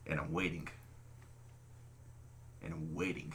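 low, soft, drawn-back storytelling; deadpan waiting. Retold or imitated. Boy left standing alone in the woods, quietly waiting. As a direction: drop low and quiet, leaving the wait hanging. Retelling a long wait alone.

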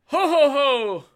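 slow, high, loud Santa laugh; jolly ho ho ho. Acted. Santa call again.

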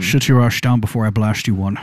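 low Scottish impression with slushy "sh"; mock Scottish film-star threat; Sean Connery. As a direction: drop low, slur s into sh. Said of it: "a Sean Connery voice".

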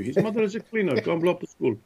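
high, hammed-up order to attack; lightly voiced Taliban boss giving orders; mock Taliban voice.

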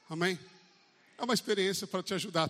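normal talking voice

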